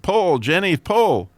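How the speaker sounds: loud, high command. Acted. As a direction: order the horse to pull, loud and firm. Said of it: "the farmer commanded".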